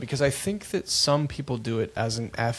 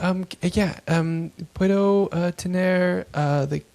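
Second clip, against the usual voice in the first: high, halting mom ordering in Spanish; hesitant learner Spanish; 6 semitones higher, 4 dB louder than usual. Retold or imitated. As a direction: stumble through a Spanish order like a nervous learner. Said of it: "my mom's like".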